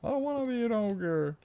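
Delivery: high mock whimper; silly high voice. Shown as a demonstration. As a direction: whine it in a tiny high voice. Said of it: "repeats in silly voice".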